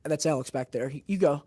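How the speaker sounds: normal talking voice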